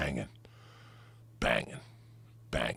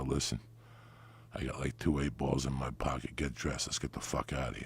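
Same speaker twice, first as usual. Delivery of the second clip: low, soft, conspiratorial; man coaxing a woman out at night, lower and softer; hushed urging; 6 semitones lower, 8 dB quieter than usual. Retold or imitated. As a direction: talk her into it under your breath. Urging her quietly at night.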